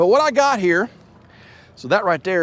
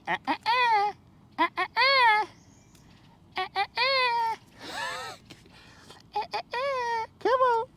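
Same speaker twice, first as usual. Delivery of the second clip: high, whiny animal noises; imitating the animal; 4 dB quieter than usual. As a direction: squeal it like a scared critter. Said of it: "He's like".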